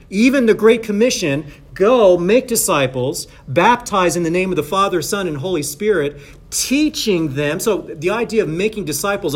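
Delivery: loud, insistent sermon delivery; emphatic preaching. Real, not acted. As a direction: drive each point home to the congregation. Preaches with raised voice.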